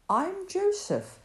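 lightly voiced Joseph greeting shepherds; Joseph's greeting. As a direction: greet the guests gently. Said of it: "said the man".

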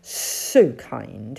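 low, oily snake hiss; Sid, hissing. Acted. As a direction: draw out the S like a snake. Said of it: "hissed Sid".

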